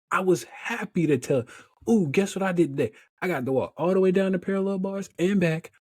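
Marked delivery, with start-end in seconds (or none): 1.9-5.7 excited, higher-pitched boasting ending in whoops; proud bragging. Retold or imitated. Patient telling visitors about the day's therapy, proudly excited. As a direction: celebrate your own win playfully.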